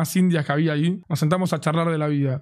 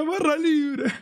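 high, strained imitation of a drunk friend; mock excited yell; 10 semitones higher, much slower than usual. Drunk friend repeating his great discovery, mock excited yell. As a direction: mimic a friend crying out in delight.

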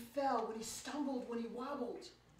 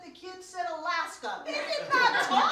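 laughing; 8 semitones higher, 14 dB louder than usual. Real, not acted. Mock-proud parent, laughing.

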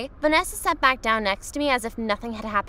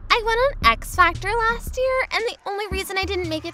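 put-on bragging girl voice; 9 semitones higher than usual. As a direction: show off.

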